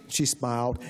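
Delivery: ordinary delivery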